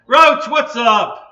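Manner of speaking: big, slow, drawn-out hello shout; loud greeting. Real, not acted. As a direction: call out to a friend joining the stream. Greeting a viewer in chat.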